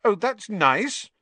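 high, drawn-out offended retort; high-pitched girlish huff; huffy. A narrator's reading. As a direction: stretch it out, huffy and loud. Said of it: "said Ginny huffily".

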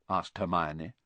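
plain reading voice

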